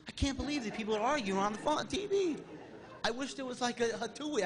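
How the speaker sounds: mock rant at the TV, pitch jumping up, breaking into a laugh; a hammed-up yell at the television news; retold yelling at the TV. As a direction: replay the shouting at the screen, half laughing. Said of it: "What are you yelling at?".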